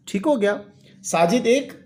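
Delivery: ordinary talk, nothing marked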